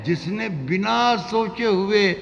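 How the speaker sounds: high, slow, drawn-out recitation; ghazal recitation. Acted. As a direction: lift the verse high and let it ring.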